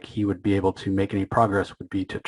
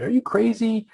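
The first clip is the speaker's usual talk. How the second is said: high-pitched incredulous outburst; retold panic, pitched high and speeding up at the end; mock flipping out; 7 semitones higher than usual. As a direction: imitate a man losing it, voice jumping up. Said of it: "Burke is just flipping out".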